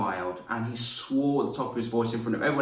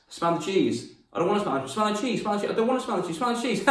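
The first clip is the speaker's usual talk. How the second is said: high, rapid-fire pestering; playful sibling teasing; 8 semitones higher, faster than usual. Retold or imitated. Teasing a brother or sister, gleeful high pestering. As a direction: needle them with a repeated high taunt.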